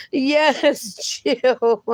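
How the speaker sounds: ordinary talk, nothing marked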